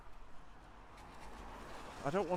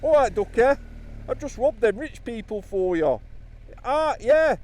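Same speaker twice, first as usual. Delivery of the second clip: loud, high East Midlands duck talk; 11 semitones higher, 6 dB louder than usual. Retold or imitated. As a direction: greet everyone as ducky, Nottingham style.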